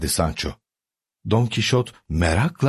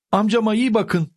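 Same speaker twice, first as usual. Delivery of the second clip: called out; 13 semitones higher than usual. A narrator's reading. Niece calling to Sancho as uncle leaves, called out. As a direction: call after them in a high voice. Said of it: "diye bağırdı Don Kişot'un yeğeni".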